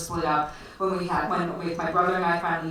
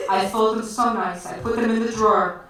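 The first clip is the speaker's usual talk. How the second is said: Armenian broken English; 3 semitones higher, 8 dB louder than usual. Retold or imitated. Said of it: "she spoke kind of in broken English".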